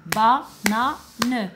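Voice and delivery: Banane split into three beats; clapped syllables. Shown as a demonstration. Teacher clapping out Banane for the children. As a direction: say ba-na-ne with a clap on each beat. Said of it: "combien de fois je vais applaudir".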